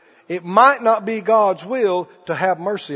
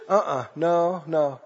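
softer, lower denial; nervous denial; 6 semitones lower, 7 dB quieter, slower than usual. Acted. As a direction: brush it off under your breath. Peter denies quietly.

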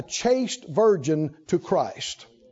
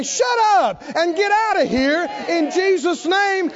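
commanding shout; 6 semitones higher than usual. Acted. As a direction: command the demons out. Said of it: "stomp our foot and say".

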